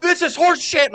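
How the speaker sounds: high, loud outraged shout at the TV; mock outraged yell. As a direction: yell it like the ref just robbed you. Retelling yelling at TV.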